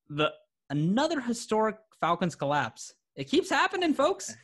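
high, hammed-up lament; exaggerated sports-host groan; mock despair. Mock lament about Falcons.

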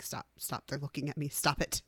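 hushed, giggly whisper; embarrassed whisper. Retold or imitated. Whispering to her partner while everyone watches, embarrassed. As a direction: hiss it so the watchers can't hear. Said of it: "I would just be like".

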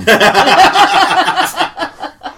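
high, rippling laughter; real high laugh that tails off; amused laugh. Real, not acted. Host amused by friend's many opinions, high laugh.

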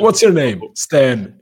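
loud yelled wrestler quote; shouted quote. Retold or imitated. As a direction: bellow it out like the ring. Acting out the Stan scene.

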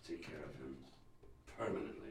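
low, soft, deadly; sinister whisper. Acted. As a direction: order it in a hush. Villain orders a killing.

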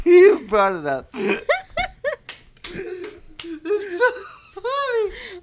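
high, breaking into helpless laughter; laughing hard. Real, not acted. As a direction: laugh through the words.

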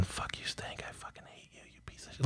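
hushed, breathless whispered insults; voiceless whisper muttered sideways at a coworker. Retold or imitated. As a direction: hiss the insults under your breath. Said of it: "he's whispering under his".

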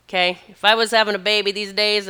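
ordinary delivery

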